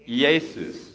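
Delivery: "Jesus" as YAY-soos, two distinct syllables; Greek pronunciation. Shown as a demonstration. Leading the church to try it, Greek "YAY-soos" for "Jesus". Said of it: "You wanna try that?".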